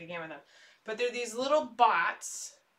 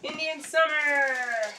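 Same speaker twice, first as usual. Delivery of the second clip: announcing; 6 semitones higher, 6 dB louder, slower than usual. Acted. Loud drawn-out game announcement.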